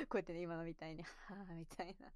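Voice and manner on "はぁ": soft, audible sigh; demonstrated sigh. Shown as a demonstration. Streamer showing viewers how she sighs when drinking, soft sigh. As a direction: let out a soft sigh to show it. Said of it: "ため息多くなります".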